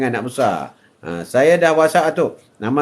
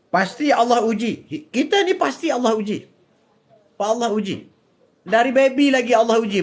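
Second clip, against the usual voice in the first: raised, sing-song preaching voice; 8 semitones higher than usual. Acted. Grandfather preaching to live viewers, raised and lively. Atuk preaching about trials, pitched up.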